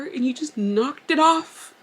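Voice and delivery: ordinary talk, nothing marked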